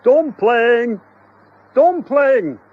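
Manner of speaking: high, loud call; husband calling for the cat; shouting. Acted. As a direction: call the cat across the house. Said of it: "Mister Doherty shouted".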